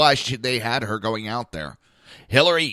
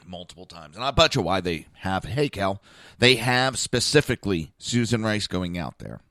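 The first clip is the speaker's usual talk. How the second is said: ordinary talk, nothing marked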